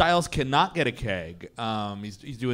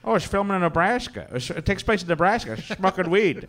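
put-on voice; 4 semitones higher than usual. Put-on character voice, joking about Nebraska.

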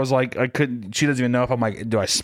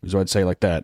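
usual speaking voice

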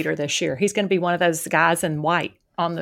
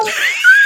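shrieking laugh; 8 dB louder than usual. Real, not acted. Mother amazed at grown kids.

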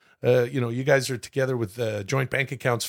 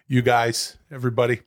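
ordinary talk, nothing marked

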